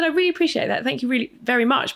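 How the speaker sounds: high, bright thank-you; grateful reply. Retold or imitated. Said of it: "I said".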